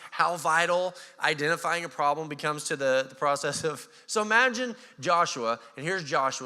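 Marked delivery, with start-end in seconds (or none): none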